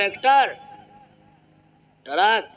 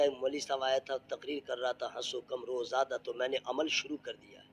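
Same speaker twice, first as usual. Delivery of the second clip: quiet retold explanation; 7 semitones lower, 12 dB quieter than usual. Husband whispering wife's complaint.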